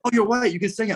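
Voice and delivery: high, excited mock local voice; a playful impression of pushy hosts; imitating eager locals. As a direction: pitch way up to mimic them. Locals urging him to sing.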